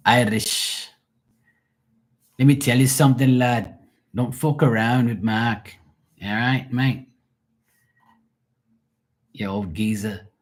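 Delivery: playful Irish accent impression; mock Irish accent. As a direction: banter in a hammed-up Irish accent. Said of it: "so you speak, uh, you know, Irish".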